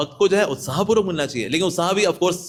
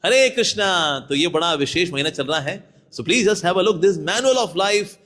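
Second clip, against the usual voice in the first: ordinary delivery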